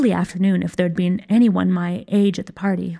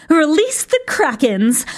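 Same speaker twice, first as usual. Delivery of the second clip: commanding call; 7 semitones higher, 3 dB louder, slower than usual. A narrator's reading. Witch commands with raised wand.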